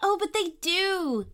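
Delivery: put-on insistent little girl. A narrator's reading. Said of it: "insisted Poppy".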